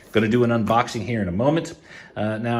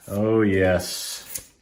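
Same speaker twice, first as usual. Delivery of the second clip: ordinary delivery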